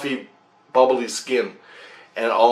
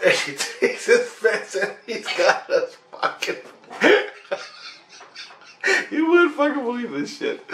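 high-pitched, breaking into laughs; laughing through words; 10 semitones higher than usual. Real, not acted. Storyteller reliving the maggot demon fight, laughing through words. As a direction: struggle to talk through real laughter.

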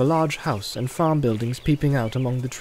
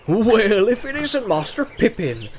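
high-pitched surprised farmer welcome; gruff farmer softening into a grin; growl turning to grin; 5 semitones higher than usual. Acted. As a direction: recognise an old acquaintance with delight. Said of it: "changing from a growl to a grin".

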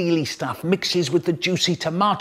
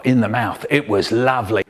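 usual speaking voice

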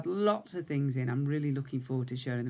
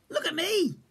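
small cub voice, much higher; bright high little bear showing off; eager little cub calling out; 3 dB louder than usual. A narrator's reading. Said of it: "said Little Bear".

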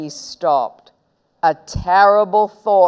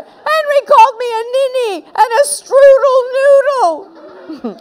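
high, loud tattling shriek; shrill, aggrieved child; screamed complaint; 7 dB louder than usual. Acted. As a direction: scream the accusation in outrage. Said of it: "screamed Peter".

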